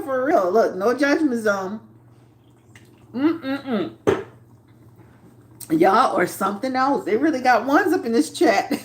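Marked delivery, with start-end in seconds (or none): none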